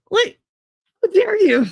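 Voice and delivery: very high outraged gasp; mock offended. Acting out her reaction to the insult, very high mock outrage. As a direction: clutch your pearls in mock outrage. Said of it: "if somebody said it to me".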